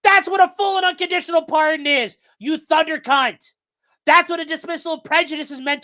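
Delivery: loud, high furious correction; real rant, slowing on the insult; angry rant. Real, not acted. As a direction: hammer the point home angrily. Ranting at judge on stream.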